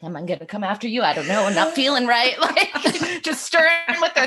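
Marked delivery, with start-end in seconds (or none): 0.0-2.4 an ostrich pacing, unsure and menacing; brooding ostrich. Pretend. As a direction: mutter it like an unstable bird. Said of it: "they might be like".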